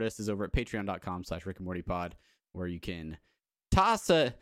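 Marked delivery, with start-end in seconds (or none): 3.7-4.3 put-on hammy British fantasy-tavern accent, raised at the start; D and D style British accent; mock British accent. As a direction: play a British innkeeper asking for coin. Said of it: "your standard D and D accent".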